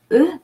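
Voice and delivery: E said as a rounded "uh", as in "le"; short neutral E, lips rounded; unaccented French E. Shown as a demonstration. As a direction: say E as French schwa, not "ee". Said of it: "because it doesn't have an accent".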